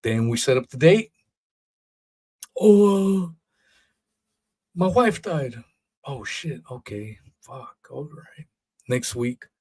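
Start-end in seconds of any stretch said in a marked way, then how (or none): 2.6-5.6 high, hammed-up sheepish excuse; mock excuse. Host mocking the Twitter guy's excuse, high hammed-up sheepish voice. 6.0-8.5 much softer, disappointed mutter; deflated reply. Retold or imitated. Host replaying his reply to the excuse, soft deflated mutter.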